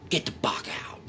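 whispered get out; hushed order. Real, not acted. Telling the presence to leave, whispered.

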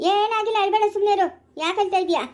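loud, high-pitched hushing; brusque consoling. Acted. Telling a crying woman nothing happened, loud and insistent.

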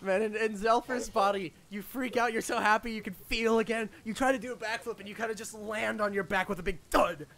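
ordinary delivery

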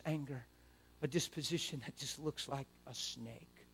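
quiet, earnest prayer voice; hushed personal prayer; soft prayer. Shown as a demonstration. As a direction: pray it softly and sincerely. Quiet model prayer continues.

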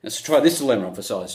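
normal talking voice

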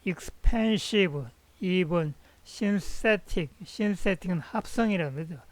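ordinary talk, nothing marked

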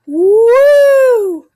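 loud high whoop of joy; excited cheer. Real, not acted. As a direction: whoop it out big. Cheering a stunt.

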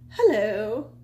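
a campy diva hello; campy drag queen. Pretend. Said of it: "I should just be like".

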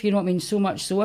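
ordinary talk, nothing marked

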